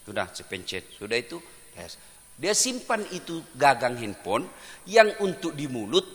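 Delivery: ordinary talk, nothing marked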